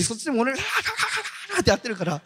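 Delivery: high, fast, laughing ガーガー imitation; laughing imitation of a noisy deliverance prayer; mock loud praying. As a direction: parody a teacher's roaring prayer while laughing. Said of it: "私よりもでかい声でですね".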